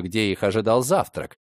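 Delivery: usual speaking voice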